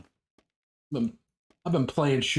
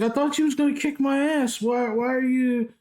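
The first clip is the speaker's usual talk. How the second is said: taunting nephew; 10 semitones higher than usual. Retold or imitated. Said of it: "And he's like".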